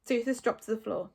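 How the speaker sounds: ordinary delivery, nothing marked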